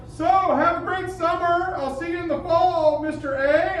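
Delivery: put-on high, slow little-kid farewell; mock high kid voice. Teacher imitating a student before summer. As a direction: pipe it up like a young student.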